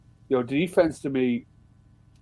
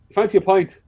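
higher, louder retold invite; eager invitation; 8 semitones higher, 8 dB louder than usual. Friend ringing at night to offer a pint, eager invitation. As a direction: lightly act out a pal's eager offer.

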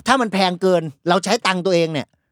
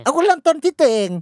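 mock nagging partner; 9 semitones higher than usual. Acted couple quarrel.

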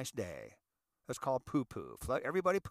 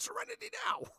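high strained yell; Seinfeld scream; faster than usual. Retold or imitated. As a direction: scream for calm, frantic. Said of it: "They were stressing out".

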